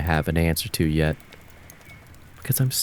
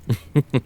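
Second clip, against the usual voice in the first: short rising scornful laugh; mocking laugh; 7 semitones higher, 4 dB louder than usual. Mocking the old tales.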